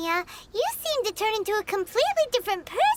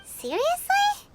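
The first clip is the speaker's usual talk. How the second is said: high, slow incredulous seriously; can't believe there's no answer; surprised disbelief; 8 semitones higher, slower than usual. Real, not acted. Companion surprised at the fireworks maker, slow disbelief.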